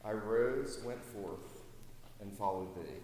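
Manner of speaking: slow, fading hymn recital; reciting hymn lyrics. Acted. Preacher reciting the hymn's conversion verse, slow and fading. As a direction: let each line sink in slowly. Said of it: "He said".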